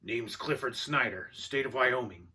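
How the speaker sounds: loud, slow introduction with authority; authoritative. A narrator's reading. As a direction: announce yourself like an official. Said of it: "said with authority".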